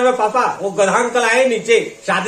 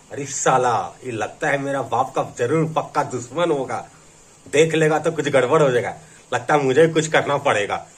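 low, softened thinking aloud; muttered aside; 10 semitones lower, 5 dB quieter than usual. Acted. Son privately sizing up his father's supposed enemy, low muttered aside.

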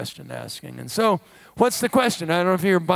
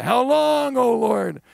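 crying out; 6 semitones higher, 4 dB louder, slower than usual. Retold or imitated. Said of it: "the prophet and the psalmist cry".

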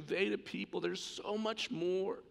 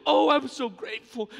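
a burst of grateful emotion, raised high and loud; overflowing gratitude; 6 semitones higher, 8 dB louder than usual. Acted. Forgiven woman at his feet, bursting with grateful emotion. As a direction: pour it out like she can't hold back. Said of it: "a token of expression to say".

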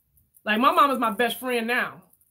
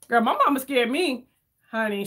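ordinary talk, nothing marked

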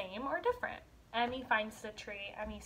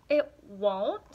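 disappointed surprise; 7 dB louder than usual. Acted. Emmy disappointed.

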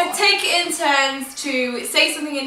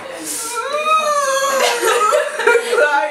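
long, high-pitched burst of laughter; real laughing fit; 10 semitones higher than usual. Real, not acted. Laughs after sitting on friend.

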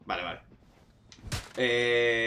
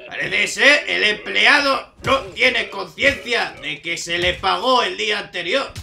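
documentary narrator; 4 semitones higher, 8 dB louder, slower than usual. Pretend. Mock narration of the game premise.

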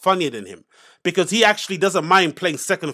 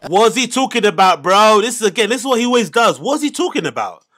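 louder, higher-pitched complaint; exasperated outburst; 4 semitones higher, 5 dB louder than usual. Real, not acted. Podcaster reacting to the clip's rambling, loud exasperation. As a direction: burst out in loud disbelief.

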